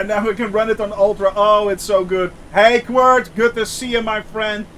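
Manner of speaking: normal talking voice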